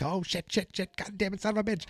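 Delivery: high, hushed frantic cursing; panicked swearing. Retold or imitated. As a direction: swear under the breath, terrified. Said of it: "he's like".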